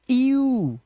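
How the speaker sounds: यू said high as a held ee-oo; ee-oo sound. Shown as a demonstration. Teacher showing how EW is read, drawn-out ee-oo for यू. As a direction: glide slowly from ee to oo in यू. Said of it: "क्या पढ़ते हैं?".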